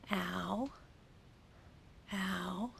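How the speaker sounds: whispered vowel sound; soft unvoiced drill sound. Shown as a demonstration. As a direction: breathe it without voice.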